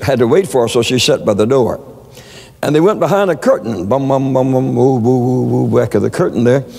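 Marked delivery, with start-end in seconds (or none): 3.9-5.8 hummed bum bums and hooting woos; spooky mock chant. Preacher mimicking the fortune teller behind the curtain with eerie mock noises.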